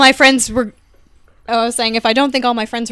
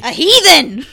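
gleefully blurted, a bit higher; excited; 3 semitones higher, 3 dB louder, slower than usual. Real, not acted. Friend jumps in to label someone, excited. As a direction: pipe up with delighted excitement. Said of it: "and rather excitedly".

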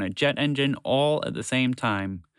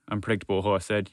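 ordinary delivery

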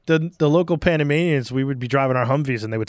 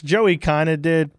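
ordinary talk, nothing marked

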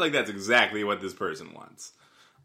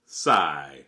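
ordinary talk, nothing marked

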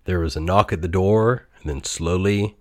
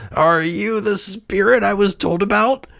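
put-on higher, wary old man's voice; frightened Scrooge; 12 semitones higher than usual. A narrator's reading. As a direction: ask fearfully. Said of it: "he asked".

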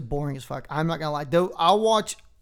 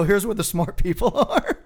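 loud and laughing; 4 semitones higher, 4 dB louder than usual. Retold or imitated. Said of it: "And I said it loud".

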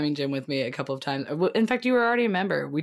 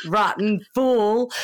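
laughing; 6 semitones higher, 6 dB louder, much slower than usual. Real, not acted. Laughing at own joke.

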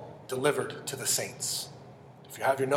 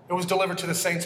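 ordinary talk, nothing marked

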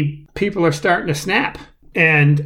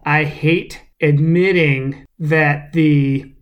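ordinary delivery

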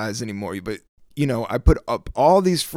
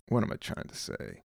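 under-the-breath whisper, searching for words; a real whispered aside, half to himself; whispered to self; 11 semitones lower, 13 dB quieter than usual. Real, not acted. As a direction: mutter it to yourself, lost in thought. Muttering to himself mid-podcast.

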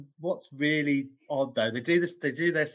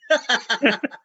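nervous laugh; 9 semitones higher, 4 dB louder than usual. Real, not acted. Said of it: "that's both a nervous laugh from both of us".

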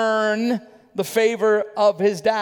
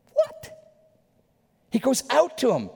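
incredulous. Acted. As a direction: squeak it in mock disbelief. Mock shock at father going out.

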